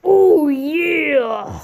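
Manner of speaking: drawn-out wrestler catchphrase impression; Macho Man. Rival wrestler comes in to do it better, mock catchphrase cry. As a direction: play a wrestler's long, swooping cry. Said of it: "Macho Man comes in, and he's like".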